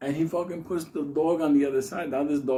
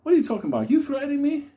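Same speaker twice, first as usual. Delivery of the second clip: confrontational; 13 semitones higher, 3 dB louder, faster than usual. Retold or imitated.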